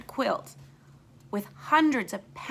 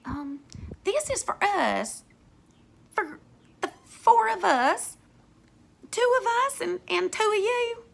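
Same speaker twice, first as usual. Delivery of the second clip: gentle woman voice; 11 semitones higher, 4 dB louder than usual. Acted. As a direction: explain it sweetly to the children. Kind woman explains to kids.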